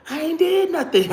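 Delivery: retold, high desperate cry of innocence; imitating her younger self begging a violent boyfriend; high pleading protest. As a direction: plead it high like you're running. Pleading while being beaten.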